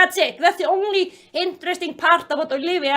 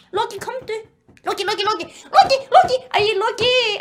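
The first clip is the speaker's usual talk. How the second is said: coaxing call to a pet; 5 semitones higher than usual. Real, not acted. Vlogger calls Loki into frame, coaxing. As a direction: call the dog over, voice hopping up.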